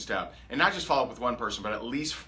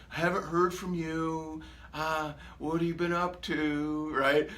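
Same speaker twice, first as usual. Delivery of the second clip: slow, whiny, needy mock voice; mock nagging ex-girlfriend; 3 semitones higher, slower than usual. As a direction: play the clingy ex who feels ignored. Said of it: "the nagging, you know, ex-girlfriend".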